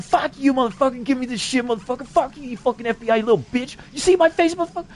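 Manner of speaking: high-pitched, acted cursing rant; retold angry tirade. Answering the voices back, acted high-pitched angry tirade. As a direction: fire insults back in a high, worked-up voice.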